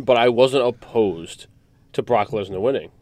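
ordinary delivery

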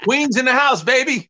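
high, laughing hometown brag; proud Queens shout-out. Real, not acted. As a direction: crow it like a true New Yorker. Proud New Yorker boast.

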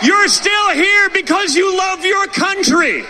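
slow, high, shouted rally cry. Real, not acted. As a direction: rouse the crowd, big and slow. Rally speech, building to crowd cheer.

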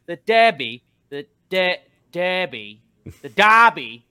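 loud, high British "Derby" as "Darby"; a friend correcting his pronunciation; mock correction. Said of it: "she would kind of correct it".

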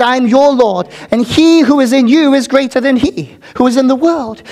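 fervent preaching, cracking near tears at the end. Acted. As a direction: declaim as God's voice, building. Preaching God's words at full force.

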